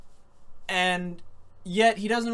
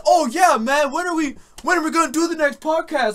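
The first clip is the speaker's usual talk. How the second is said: mock David; 8 semitones higher, 7 dB louder than usual. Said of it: "he's always telling us".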